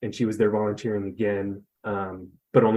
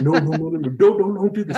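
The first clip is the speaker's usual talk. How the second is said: higher, louder frantic pleading; panicked protest; 7 semitones higher, 6 dB louder than usual. Retold or imitated. Begging Ty not to call her over, loud panicked plea. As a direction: beg him to stop before it's too late.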